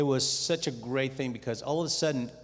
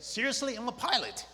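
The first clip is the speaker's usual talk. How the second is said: much higher, disbelieving objection; incredulous protest; 12 semitones higher than usual. Retold or imitated. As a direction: scoff like a doubting Israelite. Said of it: "typical Israelite".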